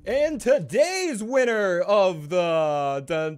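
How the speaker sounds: high, loud drawn-out announcer hype; game-show announcer. Acted. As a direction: announce the winner like a show host. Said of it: "announcing comment of the week".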